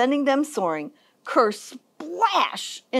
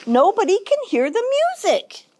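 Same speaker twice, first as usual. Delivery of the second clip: high-pitched complaint; scolding; 9 semitones higher than usual. A narrator's reading. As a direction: scold him as his exasperated mama. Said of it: "Mama scolded".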